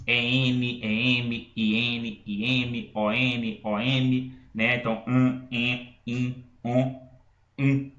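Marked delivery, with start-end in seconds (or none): 5.1-7.9 "an, en, in, on, um" as single nasal vowels, no final consonant. Shown as a demonstration. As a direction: list "an, en, in, on, um" one by one, each nasalised. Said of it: "pra formar esse som". ("an, en, in, on, um" como vogais nasais)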